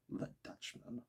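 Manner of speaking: breathy whispered aside. Real, not acted. Reader whispers the joke to himself. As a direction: whisper it to yourself. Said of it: "whispered aside".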